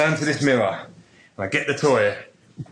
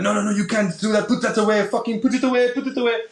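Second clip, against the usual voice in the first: high, urgent pleading to put it away; panicked friend begging him to stop; frantic French friend; 12 semitones higher, 3 dB louder than usual. Retold or imitated. Friend in the boy band begging him to cover up, high and frantic. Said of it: "and he'd be like".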